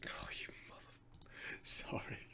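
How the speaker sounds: swearing under his breath; hushed annoyed whisper; whispered curse. Real, not acted. Video glitch, swears under breath.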